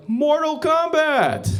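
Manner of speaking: high, loud, slow announcer-style shout; mock yell. Thai crowd filming a fight, drawn-out shout. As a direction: bellow it like the game's announcer. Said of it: "They're like".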